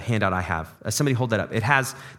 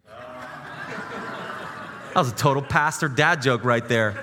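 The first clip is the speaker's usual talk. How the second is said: laughing at own joke; much slower than usual. Real, not acted.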